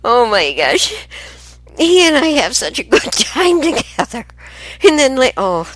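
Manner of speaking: high, loud, breaking up with laughter; laughing hard. Real, not acted. Grandmother laughing helplessly recalling the TV with her son, high and loud. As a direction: laugh through the words, helpless with giggles. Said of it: "tears in my eyes from laughing".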